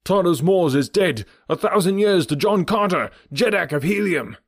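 high, loud rallying cry; crowd cry. Acted. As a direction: call it out to the crowd. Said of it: "Someone raised the cry".

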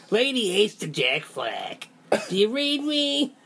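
put-on high little girl voice, slowed. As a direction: play a small girl on the radio. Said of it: "a wonderful impression ... of the character Kim Gardner".

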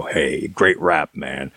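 slow, lazy mock praise from an old underground DJ; a lightly voiced stoner radio host, dropping off to almost nothing at the end; mock underground radio listener, slow and fading; laid-back radio hippie. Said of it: "You know".

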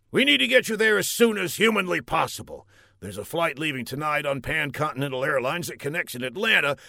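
high, fast general. Acted. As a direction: rattle off the plan. General plans flight.

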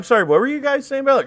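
high and fast, playing innocent; mock innocent patient. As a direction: squeak it out quickly and sweetly. Patient voiced interrupting.

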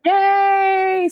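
high, drawn-out squeal of yay; thrilled, sustained high whoop; excited cheer. Real, not acted. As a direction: let out a long, high-pitched cheer. Guest cheers at first episode.

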